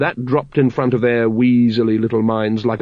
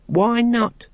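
much higher, put-out question; peeved; 12 semitones higher than usual. A narrator's reading. Brother Dunnikin defending legends, peeved. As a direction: sound offended. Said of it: "in a peeved voice".

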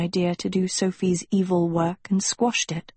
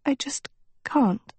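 halting, higher plea, close to breaking; voice catching; 6 semitones higher than usual. A narrator's reading. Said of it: "Her voice caught".

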